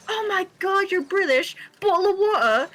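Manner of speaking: high, excited imitation of British-accent teasing; mock British accent. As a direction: mimic people hamming up a British accent. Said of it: "focus more on the accent and be like".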